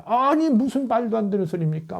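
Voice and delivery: retold indignant rebuke; mock scolding. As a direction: scold like an indignant disciple, lightly acted. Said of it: "베드로가 꾸짖어 가로되".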